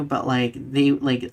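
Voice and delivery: ordinary talk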